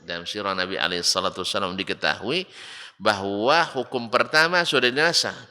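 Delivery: ordinary delivery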